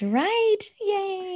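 high, celebratory squeal; real giddy high-pitched delight; delighted cheer. Real, not acted. Celebrating the gift together.

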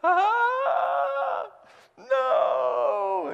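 high loud wail of dismay; anguished cry. Acted. Pastor voicing Haman's horror, loud high cry. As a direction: howl like your plan just backfired. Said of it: "His face?".

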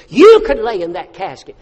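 loud warning. Retold or imitated. Preacher warning congregation.

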